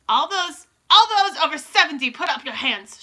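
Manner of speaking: loud, high opening, abrupt command; imperious witch giving orders; barked order. A narrator's reading. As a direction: bark it at the whole room. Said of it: "she barked suddenly".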